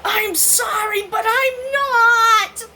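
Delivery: high, loud, dragged-out not with a cry in it; sorry, then slam down the refusal; defiant mock apology. Wife to pestering husband, shrill defiant apology. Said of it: "I'd say".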